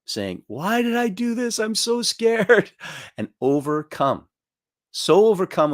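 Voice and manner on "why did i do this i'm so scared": high, slow, trembling mock whimper of fear; mock frightened weeping. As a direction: imitate a man stuck on a cliff, pitched way up, whining in terror. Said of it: "weeping with fear".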